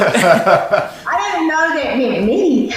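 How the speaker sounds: slow, laughing embarrassed protest. Real, not acted. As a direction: laugh it out, caught off guard. Surprised to be asked to pray.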